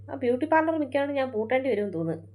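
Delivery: ordinary talk, nothing marked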